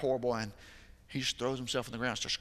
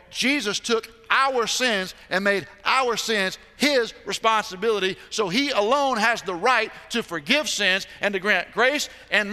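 loud, high preaching at full force; fervent pulpit preaching; 8 semitones higher, 10 dB louder than usual. Acted. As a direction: preach it out to the congregation.

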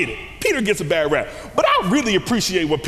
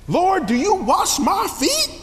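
much higher incredulous question; Peter incredulous; 13 semitones higher, 3 dB louder than usual. Retold or imitated. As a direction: squeak out Peter's disbelief. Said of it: "What does he say?".

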